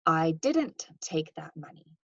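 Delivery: slow, "didn't" punched in denial; slow line with the weight on "didn't"; stress on didn't. Shown as a demonstration. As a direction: deny it by hitting "didn't". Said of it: "Note that there's kind of some denial here".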